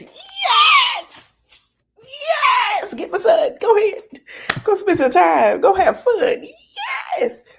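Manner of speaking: very high, loud laughing shouts of joy; a mom celebrating her kid leaving for the weekend, shrieking; mock joyful cheering. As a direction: play the thrilled mom, squealing with laughter. Mom cheering weekend without kids.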